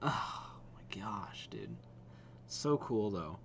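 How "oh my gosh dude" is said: slow, whispered gasp of awe; breathy amazement. Real, not acted. Streamer marvelling at the game trailers, whispered amazement. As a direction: draw it out softly, almost all breath. Stunned after watching the trailers.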